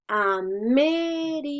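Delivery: América drawn out, long stressed "mé"; teacher sounding out América slowly, leaning on "mé". Shown as a demonstration. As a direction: stretch América to show the strong "mé". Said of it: "la mayor fuerza la hacemos en me".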